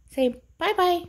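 high chirpy bye-bye for the dogs; sing-song goodbye. Real, not acted. Dog sign-off.

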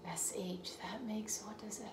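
whispered to herself; whispering. Real, not acted. Teacher puzzling over the letters, whispering. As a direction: whisper it under your breath.

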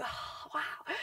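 soft whispered oh wow; a whispered, impressed oh wow; awed whisper. Retold or imitated. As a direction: hush it out, impressed. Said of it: "I'm like".

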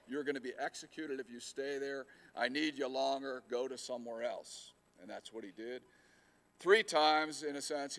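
usual speaking voice

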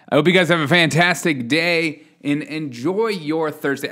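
ordinary talk

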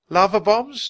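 high, loud startled pipe; piping voice. A narrator's reading. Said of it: "Jack piped".

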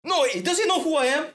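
put-on high, outraged Armenian-accented protest; imitated indignant Armenian. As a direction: mimic a self-important man protesting to police. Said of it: "This Armenian guy kept wanting".

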